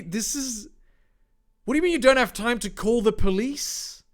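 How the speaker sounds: normal talking voice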